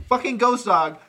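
ordinary talk, nothing marked

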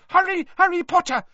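loud, very high elf cry; piercing house-elf squeak; Dobby squeaking. A narrator's reading. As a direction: squeal it urgently like a frantic little elf. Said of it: "squeaked Dobby".

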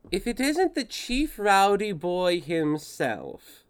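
a hint of a high, slow ship AI greeting; intercom voice. Ship AI Bella greets over intercom.

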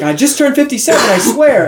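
high-pitched, eager retold claim; insistent protest. Homeless man vouches for his birthday, eager retold insistence. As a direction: swear to it, voice lifted high.

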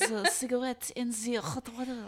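cigarette said the French way, light mock accent. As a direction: mimic his French way of saying cigarette. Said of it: "That's exactly how he said it".

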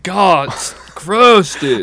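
very slow, high, laughing mock drawl; mock valley voice. Mocking disgust at a hickey story, slow high drawl. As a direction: stretch it out grossed out. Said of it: "talk like that".

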